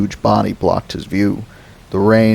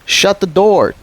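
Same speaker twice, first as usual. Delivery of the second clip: put-on high, louder urgent boy's order; 12 semitones higher, 4 dB louder than usual. Boy orders his sister to shut the car door, urgent. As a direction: bark it at your sister.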